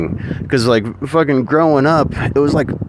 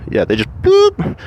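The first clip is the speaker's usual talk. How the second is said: squeaky boop. Retold or imitated. Sound effect for conquest.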